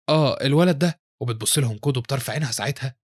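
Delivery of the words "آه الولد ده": slightly flustered reply; nervous. A narrator's reading. Mother asked about her missing son, nervous. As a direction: answer with a touch of unease. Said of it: "فبتقول أمها بتوتر".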